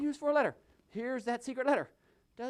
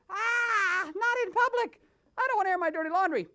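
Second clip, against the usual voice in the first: higher puppet voice, fast at the end; put-on sheriff voice; 8 semitones higher than usual. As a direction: protest like a fussy sheriff.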